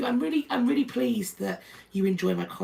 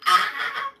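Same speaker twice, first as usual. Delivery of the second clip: short delighted scream, very high; real squeal of delight; excited high shriek; 6 dB louder than usual. Real, not acted. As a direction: let out a joyful shriek. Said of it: "I'm really excited".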